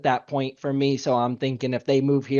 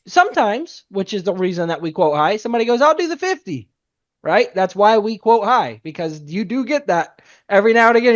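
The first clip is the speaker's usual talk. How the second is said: excited; 7 semitones higher, 6 dB louder than usual. Real, not acted. Excited aside about high quotes.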